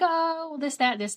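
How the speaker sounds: high, sing-song mock small talk; chatty. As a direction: gloss over the news in a high chatty lilt. Acting out chatty catch-up with doctor.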